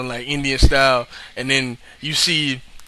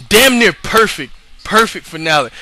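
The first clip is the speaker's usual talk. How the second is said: higher, louder and slowed, savouring it; excited praise; 5 semitones higher, 5 dB louder than usual. Real, not acted. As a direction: rave about the ending. Raving about the finale.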